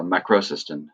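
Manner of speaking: "macrosystem" said as one word; run together. Shown as a demonstration. Teacher showing how an enclitic leans back, "macrosystem" run together. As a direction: link "macrosystem" with no break inside. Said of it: "like, one word".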